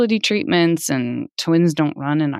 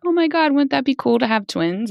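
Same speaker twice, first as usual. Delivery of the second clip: much higher, lightly gushing; playful retold daydream, pitched up; joking wishfulness; 10 semitones higher than usual. Parents joking during a first pregnancy, lightly excited. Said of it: "you always kinda joke".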